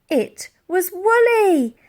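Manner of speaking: slow, high, loud joyful announcement; excited reveal. A narrator's reading. Narrator reveals the mammoth saving Elsie, excited. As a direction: announce the rescuer with delight.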